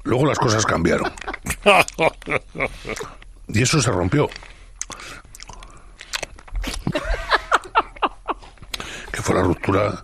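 talking around a mint; sucking a candy while speaking; mouth full. Real, not acted. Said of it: "escucho algo en tu boca".

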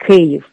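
Kyiv with its non-Castilian Ukrainian vowel; Ukrainian pronunciation. Shown as a demonstration. Guest explains vowels to host, Kyiv said Ukrainian-style. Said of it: "vocales que no dicen en castellano".

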